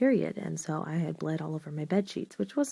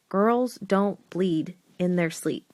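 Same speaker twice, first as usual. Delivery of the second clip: ordinary talk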